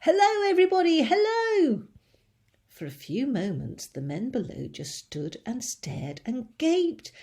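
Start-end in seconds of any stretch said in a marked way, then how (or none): 0.0-1.8 high, loud cheerful call. A narrator's reading. Boy calling hello down to the crowd. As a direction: call out happily from the roof. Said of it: "laughing and waving and calling out".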